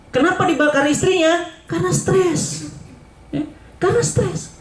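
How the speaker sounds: high, slow sing-song; high, drawn-out mock reply. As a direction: drag out the answer mockingly. Mocking rhetorical question and answer.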